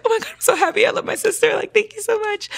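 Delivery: very high-pitched gushing thanks; elated. Retold or imitated. Thanking her sister for the relief, elated. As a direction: squeal it with happy gratitude. Retold happy reply to sister.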